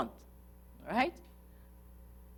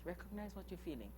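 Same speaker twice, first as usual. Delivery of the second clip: much softer, almost under the breath; soft repeat; 19 dB quieter, faster than usual. Shown as a demonstration. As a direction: repeat it gently to yourself. Repeats instruction, then softer.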